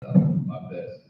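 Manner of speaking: fighting not to cry, barely getting the words out; holding back tears. Real, not acted. Son fighting tears during his father's eulogy, choked near-silent voice. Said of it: "I'm not gonna cry".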